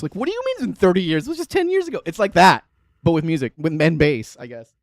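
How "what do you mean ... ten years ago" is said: rushed start climbing to a high-pitched protest; acted-out baffled protest, pitch shooting up; mock disbelief. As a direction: play up incredulous denial. Repeats the disbelieving millennial reply.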